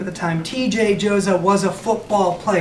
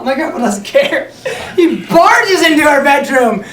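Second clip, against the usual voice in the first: normal talking voice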